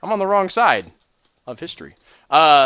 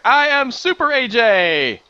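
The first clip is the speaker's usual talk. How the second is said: put-on much higher, louder, slow heroic toddler announcement; kid superhero shout; 10 semitones higher, 8 dB louder, slower than usual. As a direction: belt it like a tiny superhero. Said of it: "He's like".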